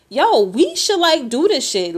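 hyped-up yo to a buddy; a retold burst of excitement; excited. Retells her friend's excited pitch.

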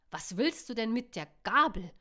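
loud, upset burst; loud exasperated question; agitated outburst. A narrator's reading. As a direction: blurt it out, worked up. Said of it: "prustete Luke aufgebracht".

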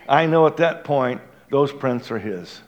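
ordinary delivery

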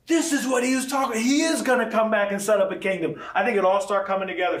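light up as it clicks; a preacher's sudden aha; excited realization. Real, not acted. Realization mid-sermon, excited.

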